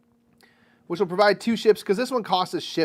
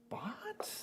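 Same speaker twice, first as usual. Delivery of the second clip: whispered what; 10 semitones higher, 18 dB quieter than usual. Real, not acted. Hushed question.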